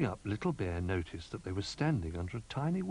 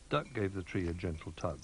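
usual speaking voice

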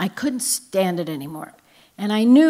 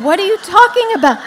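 much higher and louder mock disbelief; shocked mother; 12 semitones higher, 12 dB louder, faster than usual. Mother hearing her daughter confess, hammed-up shock. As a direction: play the mom who can't believe her ears.

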